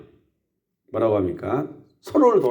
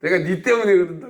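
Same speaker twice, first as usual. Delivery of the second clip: mock blaming; 5 semitones higher than usual. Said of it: "남편한테 하고".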